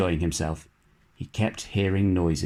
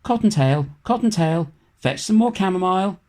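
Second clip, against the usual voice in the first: high, louder call from inside the burrow; an aunt calling into the rabbit hole, raised and hurt; 10 semitones higher, 4 dB louder than usual. A narrator's reading. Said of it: "hurt voice was heard inside the rabbit hole calling".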